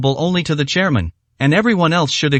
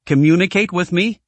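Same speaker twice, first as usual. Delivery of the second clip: doubtful; 3 semitones higher than usual. A narrator's reading. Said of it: "asked doubtfully".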